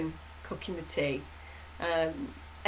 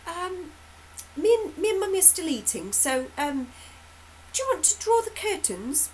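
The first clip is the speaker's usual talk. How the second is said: put-on high, gentle voice to a toddler; sweet talking to a child; 7 semitones higher than usual. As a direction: coax a little child sweetly. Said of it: "my husband responds and goes".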